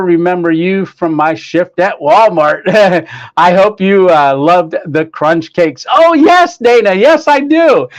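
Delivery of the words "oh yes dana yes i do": excited greeting. Real, not acted. Excited reply to a viewer's chat.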